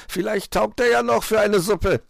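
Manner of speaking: high, loud fox jibe; amused fox. A narrator's reading. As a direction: toss it out as a merry taunt. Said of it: "lachte Herr Fuchs".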